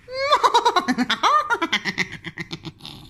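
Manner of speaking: high evil cackle; evil witch cackle. Acted. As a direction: cackle like an old hag. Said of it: "do you want to hear my evil, evil witch laugh".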